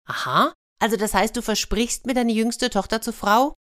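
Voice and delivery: high-pitched checking question, rising at the end; insistent hedgehog. Acted. Hedgehog makes sure of the promise.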